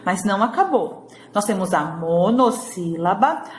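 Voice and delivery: normal talking voice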